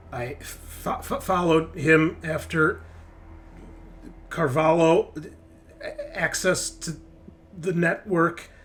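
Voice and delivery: high, broken-up robot speech with long gaps; glitching android. Acted. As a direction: stutter and stall like a machine shutting down. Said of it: "then he starts to glitch out".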